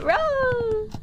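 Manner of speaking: high, drawn-out teasing holler; mock yell to needle a teammate; playful yelling. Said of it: "I'm gonna keep yelling".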